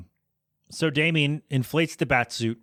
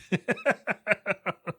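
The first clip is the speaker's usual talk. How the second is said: a high breathy laugh at the insult; amused laugh; 7 semitones higher than usual. Real, not acted. Laughing at the Joker's insult, amused. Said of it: "So mean".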